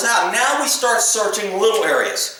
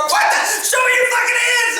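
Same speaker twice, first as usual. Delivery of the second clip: panicked scream; 13 semitones higher than usual. Retold or imitated. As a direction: scream it like you've been caught off guard. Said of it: "I scream like a little girl".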